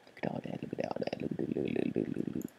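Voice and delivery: hushed awed whisper. Real, not acted. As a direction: whisper in wonder. Whispered awe at loot.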